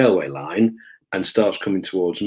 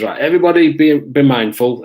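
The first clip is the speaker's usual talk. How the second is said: raised, higher voice of a handler; calling out a warning; 6 semitones higher, 7 dB louder than usual. Retold or imitated. Dog handler warns the other officers, calling out. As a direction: imitate a handler addressing everyone.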